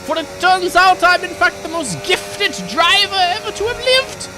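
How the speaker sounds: high, loud, hammed-up villain-style reveal; mock-triumphant gloating. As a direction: crow it at full volume, way up high. Mock-triumphant gloating while winning the race.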